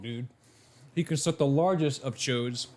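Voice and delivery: ordinary delivery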